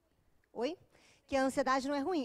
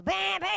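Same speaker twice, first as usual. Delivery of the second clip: loud, high double honk; imitated honking; 6 semitones higher, 6 dB louder than usual. Girlfriend leaning on boyfriend's horn, imitated honks. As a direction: blare it like a car horn. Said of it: "enfio a mão assim no volante dele e faço".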